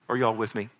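ordinary delivery

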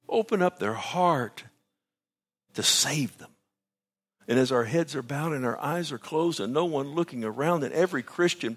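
high, loud preaching appeal; altar call. Real, not acted. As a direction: urge them to come to Jesus. Altar call at full force.

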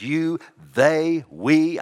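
"you", "they", "we" as separate high, emphatic beats; emphatic, almost yelled. Shown as a demonstration. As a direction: punch out "you", "they", "we" one by one. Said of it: "almost like he's yelling you".

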